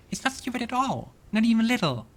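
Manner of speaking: put-on a small girl eagerly reassuring her friend; childlike girl. Companion Ivy reassures Lan.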